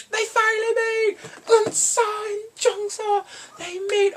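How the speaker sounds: very high, loud, drawn-out fan squeal; hammed-up tearful excitement; overjoyed shriek. Real, not acted. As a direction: cry out the long-awaited news slowly. Overjoyed at the siblings' reunion.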